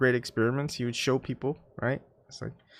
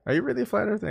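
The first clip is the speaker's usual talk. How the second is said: imitating his brother, much higher and disbelieving; incredulous brother; 9 semitones higher, much faster than usual. Brother asking in disbelief, mock high incredulous voice. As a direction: play the shocked brother, voice jumping up high. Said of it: "even my brother is like".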